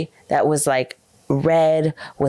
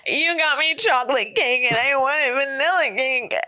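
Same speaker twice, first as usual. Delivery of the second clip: high, whiny mock tantrum; imitating spoiled brat; 8 semitones higher than usual. Spoiled girl complaining to parents about cake, high mock whine. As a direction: whine it like a bratty birthday girl. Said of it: "they'd be like".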